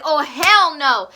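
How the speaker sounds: loud, drawn-out retold refusal; indignant outburst. Girl pounding her fist on the table, indignant outburst.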